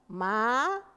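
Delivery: long drawn-out, rising groan of a word; whining reluctance. Acted. Child facing a hindrance, lazy whine.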